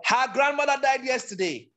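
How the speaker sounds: very high, held-up pitch; demonstrating the top of the pitch range. Shown as a demonstration. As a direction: pitch the whole sentence way up high. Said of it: "obviously that's high pitch".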